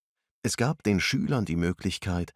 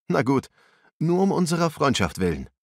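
higher, louder, good-natured giving in; smiling agreement; 8 semitones higher, 5 dB louder than usual. A narrator's reading. Ruslan agreeing to help Arthur, higher and smiling. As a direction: agree with a smile for friendship's sake. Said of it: "lächelte Ruslan".

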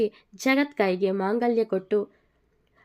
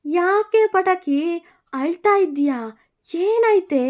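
alarmed; 9 semitones higher, 7 dB louder than usual. Acted. Finds bride crying, alarmed.

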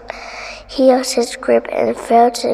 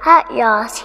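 ordinary delivery

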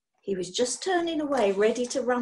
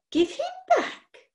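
slow, much higher squeak of outrage; outraged squeak; slower than usual. Acted. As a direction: let the voice climb in disbelief. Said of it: "Her voice had risen to an outraged squeak".

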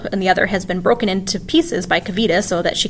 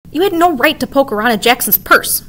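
angry; 6 semitones higher, 5 dB louder than usual. A narrator's reading. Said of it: "Vic says angrily".

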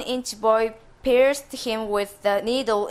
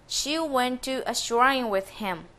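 plain reading voice

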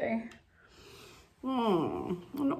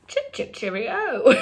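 loud, slow, high British farewell; British accent; 5 semitones higher, 4 dB louder, slower than usual. Acted. Playing British with rose-colored glasses on, British accent. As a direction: draw out a hammy British sign-off, laughing. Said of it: "now I'm British".

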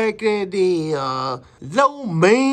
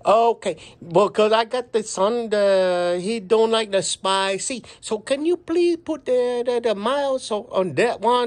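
put-on customer ordering in a thick Chinese accent. Customer asking restaurant about spice, in a Chinese accent. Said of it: "we're gonna do a Chinese accent".

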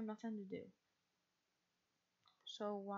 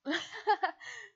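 loud, high burst of laughing; real cackling laugh after a yawn; burst of laughter; 8 semitones higher, 11 dB louder than usual. Real, not acted. Laughing right after a big yawn.

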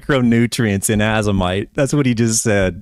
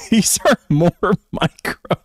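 laughing mock quote; 5 semitones higher than usual.

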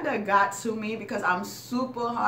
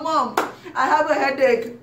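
higher, louder, laughing mock complaint; mock whiny kid; 8 semitones higher, 8 dB louder than usual. Kid tells mom about a headache, higher laughing mock whine. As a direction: whine it like a kid faking illness.

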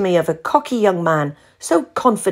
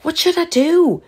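higher, begging friend; pleading; 6 semitones higher than usual. A narrator's reading. Val pleading with Ruth on the phone for help. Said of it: "Val pleaded".

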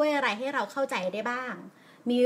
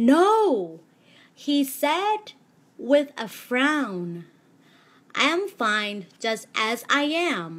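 storybook read with deliberate pauses at punctuation; pausing at commas; 6 dB louder, much slower than usual. Shown as a demonstration. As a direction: read slowly with a clear stop at each comma. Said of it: "พอเจอ full stop อีกก็หยุดอีกค่ะ".

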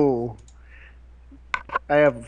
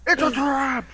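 high-pitched mock alarm; over-the-top warning cry; mock alarm call; 11 semitones higher, 3 dB louder, slower than usual. Shouting out a catchphrase.

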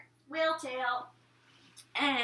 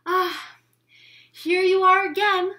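friendly ladybug; 7 semitones higher, 8 dB louder than usual. Acted. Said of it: "said the friendly ladybug".

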